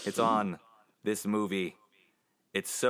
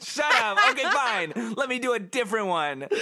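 much higher and louder, giggling shut up; laughing protest; 11 semitones higher, 5 dB louder than usual. Real, not acted. Protesting the co-host's teasing while laughing. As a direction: fight through the laughter.

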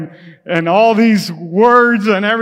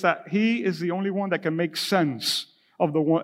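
usual speaking voice

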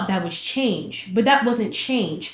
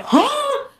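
high startled huh; shocked squeak. Retold or imitated. Reacting to the dude threatening with a Glock, high and startled. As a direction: squeak it out in disbelief.

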